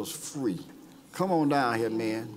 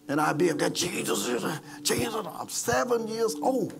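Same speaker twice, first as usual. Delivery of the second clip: higher, louder preacher's build; preaching at full force; 6 semitones higher, 4 dB louder than usual. Acted. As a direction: preach it out to the congregation. Pastor preaching his testimony.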